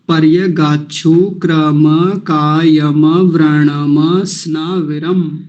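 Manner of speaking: पर्य गाछु क्रम कायम व्रणम स्नाविरम, slowly; slow recitation. Shown as a demonstration. Teacher reciting again slowly for practice. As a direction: chant each word slowly and clearly. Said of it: "फिर से देखिए आराम से".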